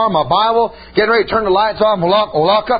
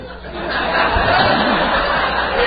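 airy burst of laughter; breathy laugh. Real, not acted. Preacher laughing about the brothers' guns, breathy laughter. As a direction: chuckle breathlessly at the joke.

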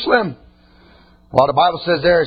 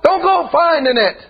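preaching at full force; 10 semitones higher than usual. Acted. Preacher shouting to the congregation to let it die. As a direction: preach it hard, pushing each line up.

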